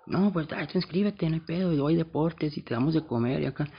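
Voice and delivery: hammed-up sales pitch; mock pushy recruiter. School worker coaxing a straight-A kid to enroll, mock pushy. School staff pushing after-school program.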